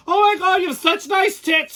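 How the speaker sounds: put-on high, squealy girly gush; mock gushing woman. Mocking women gushing.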